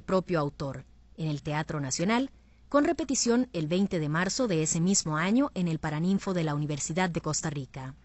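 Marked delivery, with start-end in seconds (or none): none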